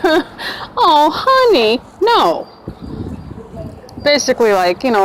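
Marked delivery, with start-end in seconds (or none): 0.0-2.5 high, laughing "oh honey, no" in a pitying tone; laughing condescension. Retold or imitated. Officer laughing at a naive new arrestee, much higher and pitying. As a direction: laugh it off like she's talking to a clueless kid. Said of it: "she starts laughing".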